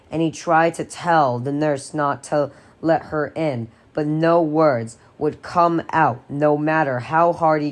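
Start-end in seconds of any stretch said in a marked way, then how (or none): none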